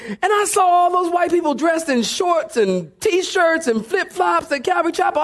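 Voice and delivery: ordinary talk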